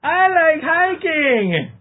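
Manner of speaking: put-on very high, very slow quavering old man. Friend mocks him as a grandpa on a date, very high and slow. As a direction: warble it like a whiny old grandpa. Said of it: "Okay, Grandpa".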